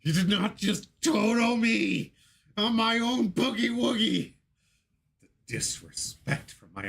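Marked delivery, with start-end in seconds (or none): 0.0-4.3 slow, high mock scream; high drawn-out anguished yell; internal scream. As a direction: wail it out like you were robbed. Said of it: "screaming internally".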